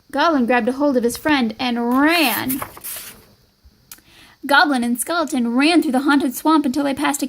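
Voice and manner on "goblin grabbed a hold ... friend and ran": plain reading voice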